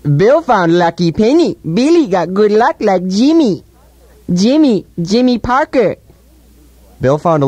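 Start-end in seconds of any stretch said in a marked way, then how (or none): 0.0-6.0 put-on childlike voice. Mocking childlike talk about Bill.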